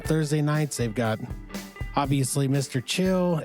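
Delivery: ordinary talk, nothing marked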